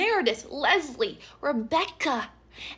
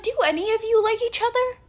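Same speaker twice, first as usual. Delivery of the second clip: higher, fast disbelieving question; incredulous; 9 semitones higher, faster than usual. Retold or imitated. New girl asking the catty moms in disbelief, higher and fast. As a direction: ask in disbelief.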